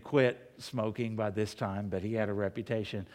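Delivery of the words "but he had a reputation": usual speaking voice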